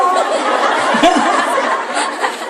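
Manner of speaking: high unvoiced giggle; breathy laugh. Real, not acted. Preacher cracking up at his own story, airy giggle. Audience-facing laugh after punchline.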